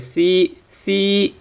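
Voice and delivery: "C" with a long, high, held ee. Shown as a demonstration. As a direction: hold the vowel of "C" on a high level pitch. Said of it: "is a long E sound".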